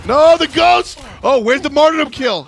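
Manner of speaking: high, loud play call; excited shout. Real, not acted. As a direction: yell at the big play.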